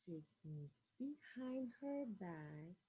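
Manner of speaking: soft and slow, tiptoeing; hushed sneaky narration. A narrator's reading. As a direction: creep through the words quietly. Sneaking up on Olivelle.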